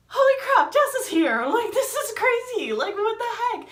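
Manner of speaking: loud, very high-pitched amazement; acted excited shrieking. Friends amazed she made the bus, loud excited squeal. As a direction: re-enact a wild, squealing reunion.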